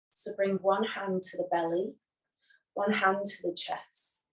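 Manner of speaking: unhurried, soothing yoga nidra voice; guided meditation. Acted. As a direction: guide them gently into rest, leaving long silences. Leads a guided relaxation.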